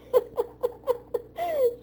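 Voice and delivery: a burst of high real laughter. Real, not acted. Laughing at her own drowning joke, high laugh.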